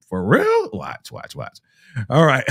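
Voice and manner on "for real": high, mock-incredulous question; imagined disbelief. Host acting out Ashley's coming reaction, mock disbelieving. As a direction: voice leaps up as if you can't believe it. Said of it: "You're gonna be like".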